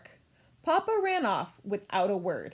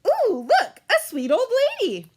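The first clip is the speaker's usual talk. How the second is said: high, loud, fast delighted ooh; thrilled to see a sweet old lady; excited spotting; 12 semitones higher, 6 dB louder than usual. Acted. Gretel spots the witch.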